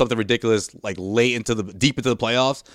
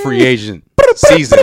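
hype announcement; 4 semitones higher, 9 dB louder than usual. Real, not acted. Announcing free agent day.

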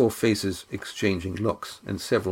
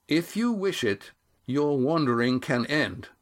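proclamation; 4 semitones higher than usual. A narrator's reading. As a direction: declare it like a decree, measured. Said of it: "instead voiced a proclamation".

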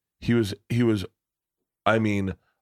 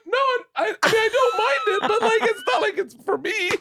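laughing while talking. Real, not acted. Laughing while retelling fan story.

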